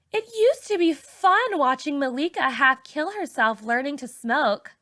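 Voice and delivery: put-on high sneering teen girl; Raina's mockery. A narrator's reading. Raina mocking narrator.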